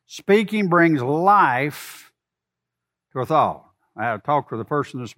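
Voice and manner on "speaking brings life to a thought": slow emphatic repeat. Shown as a demonstration. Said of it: "Are you with me now?".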